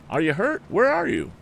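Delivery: calling out loud and high into the woods; cried out. A narrator's reading. Tom calling into the dripping woods, a high cry. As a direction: shout it, searching for someone. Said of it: "cried Tom".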